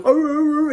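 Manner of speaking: high, loud yelping bear cries. Retold or imitated. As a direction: yelp like a bear shot in the butt. Imitating the shot bear's cries.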